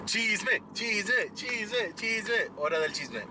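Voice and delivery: ordinary talk, nothing marked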